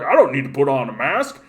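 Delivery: mock a rural boss whining about vaccines; hammed-up country bumpkin rant. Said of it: "the same type of people who are like".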